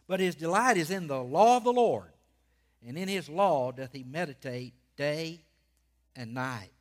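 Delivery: measured reading with long pauses and punched key words; emphatic scripture reading. Real, not acted. Preacher reading the first Psalm to his church, emphatic proclamation. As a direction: proclaim the verses phrase by phrase.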